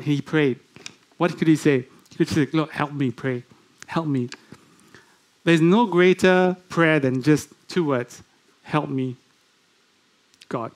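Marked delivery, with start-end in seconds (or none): 2.5-4.3 soft, pleading prayer; quiet plea. Shown as a demonstration. 8.6-10.7 soft humble two-word prayer; quiet plea. Shown as a demonstration.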